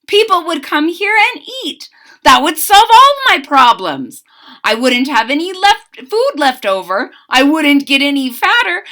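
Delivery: high, loud, eager voice; excited planning. A narrator's reading. Cook talking himself into selling food, high and excited. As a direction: bubble over with the plan.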